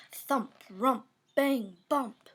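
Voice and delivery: plain reading voice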